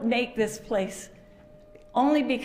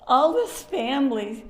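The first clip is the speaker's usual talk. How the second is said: higher, breaking with tears and laughter; choked up; 7 semitones higher, 4 dB louder than usual. Real, not acted. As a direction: fight back tears while you speak. Tearful at a memorial.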